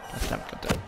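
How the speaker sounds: frantic whispered cursing; being chased. Real, not acted. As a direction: whisper it in hushed panic. Monster nearby, hiding.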